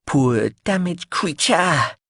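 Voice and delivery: high, slow sing-song; sing-song echo. Acted. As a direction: repeat it with a lilting rise. Biscuitine repeating phrase louder.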